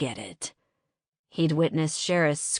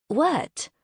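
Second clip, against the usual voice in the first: startled what; 10 semitones higher, 3 dB louder than usual. Acted. Alarmed question to Thor.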